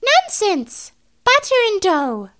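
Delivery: put-on high, loud bossy old rat; scolding old rat voice. A narrator's reading. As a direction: snap back like a bossy old lady. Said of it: "replied Anna Maria".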